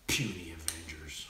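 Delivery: low, slow, scornful monster voice; Hulk-style growl. Pretend. Pretending the kilted coaches cameo as Hulk, slow low sneer. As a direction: sneer it down like a giant brute. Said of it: "I'd be like".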